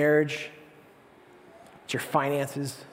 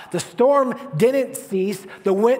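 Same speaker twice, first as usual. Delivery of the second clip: usual speaking voice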